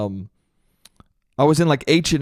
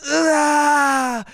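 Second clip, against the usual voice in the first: much higher, strained mock "ahh"; pained wail; 12 semitones higher than usual. Mimicking girlfriend's subtle reaction, exaggerated pained wail. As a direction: imitate her drawn-out cry of pain. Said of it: "Based on the subtleties of her going".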